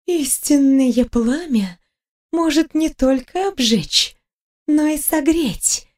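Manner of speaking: медленный, повыше обычного голос огненного духа; прошипи нараспев, не торопясь; шипящая саламандра. A narrator's reading. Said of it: "прошипел элементаль". (тягучее шипение огненной саламандры)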